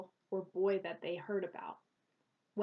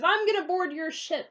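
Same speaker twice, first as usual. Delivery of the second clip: threatening pirate; 7 semitones higher, 9 dB louder than usual. Acted. Villain pirate threatens to board.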